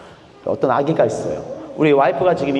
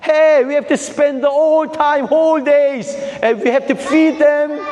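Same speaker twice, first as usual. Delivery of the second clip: high, slow, drawn-out complaint; mock exasperated parent; 9 semitones higher, much slower than usual. Preacher mimics a tired parent complaining about babies, high and drawn out. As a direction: whine it like a put-upon parent.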